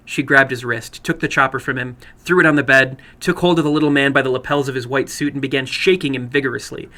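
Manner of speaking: plain reading voice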